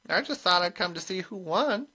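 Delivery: put-on high-pitched, mild old witch; high, unassuming little voice; innocent old witch. A narrator's reading. Granny voiced in the story.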